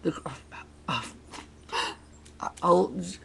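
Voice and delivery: ordinary delivery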